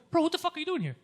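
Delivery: high-pitched retold confrontation; exasperated demand. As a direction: confront the driver in disbelief. Confronts the waiting shuttle driver.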